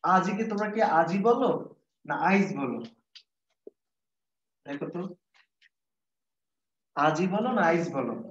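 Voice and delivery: usual speaking voice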